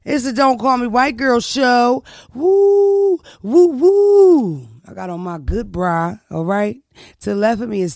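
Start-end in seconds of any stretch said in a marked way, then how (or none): 0.0-2.0 punchy, raised hype announcement; loud show intro. Real, not acted. Host opening her own show, loud hype. 2.3-4.7 high, loud drawn-out whoops; hype whoops. Real, not acted. Host celebrating her show's opening, loud whooping.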